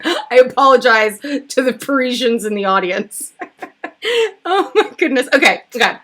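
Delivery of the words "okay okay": ordinary talk, nothing marked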